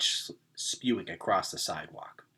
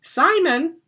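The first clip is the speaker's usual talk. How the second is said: put-on high, louder old woman's greeting; relieved grandma; 9 dB louder than usual. A narrator's reading. Confused grandma mistaking Gregor for Simon, high relieved call. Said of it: "she said".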